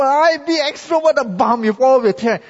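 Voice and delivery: high, loud, brash mock extrovert; pushy extrovert. Pastor mimicking loud extroverts for the congregation, hammed up. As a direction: ham up an overeager talker. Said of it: "I mean, some people".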